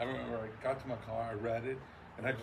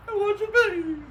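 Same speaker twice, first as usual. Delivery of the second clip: very high, loud squeal; Christmas Story kid; 15 dB louder than usual. Retold or imitated. Very high loud kid squeal from A Christmas Story. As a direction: shriek it like a caught kid. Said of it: "like the little kid on, uh, Christmas Story".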